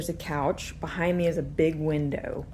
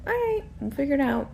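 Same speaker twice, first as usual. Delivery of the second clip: put-on cheerful babysitter; 10 semitones higher, 3 dB louder, faster than usual. Babysitter voicing her cheerful self.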